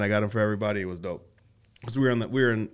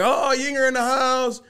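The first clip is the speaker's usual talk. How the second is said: excited greeting; 10 semitones higher than usual. Retold or imitated. Retold greeting to friends.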